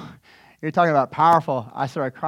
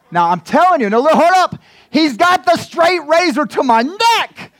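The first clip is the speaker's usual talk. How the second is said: higher and louder, wound up about the razor; alarmed excitement; 11 semitones higher, 9 dB louder than usual. Real, not acted. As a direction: hold up, can you believe this. Razor at his neck, alarm.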